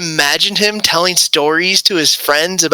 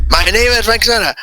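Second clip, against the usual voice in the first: put-on Inigo Montoya accent; 5 semitones higher than usual. Inigo Montoya-style Spanish accent, challenging the bully to a duel. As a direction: declaim it like a swashbuckling Spanish swordsman. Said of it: "The Inigo Montoya accent".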